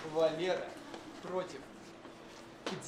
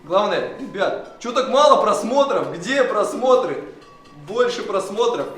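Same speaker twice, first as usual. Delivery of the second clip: mock outraged shouting; 6 semitones higher, 13 dB louder than usual. Host demanding more views.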